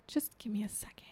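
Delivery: whispered, unvoiced aside; quick secretive whisper; hushed reply. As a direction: whisper it under your breath. Retold hushed reply to friend.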